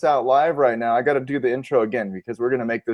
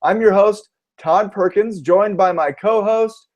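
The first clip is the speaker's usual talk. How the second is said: upbeat, raised host voice; podcast host intro; 5 semitones higher than usual. Acted. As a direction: announce the show with energy. Redoes the show intro.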